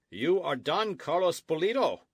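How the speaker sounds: a loud, official demand. A narrator's reading. Sergeant confronting Don Carlos, loud official challenge. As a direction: call it out so the whole veranda hears. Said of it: "he asked in a loud voice".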